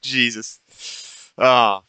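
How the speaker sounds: ordinary talk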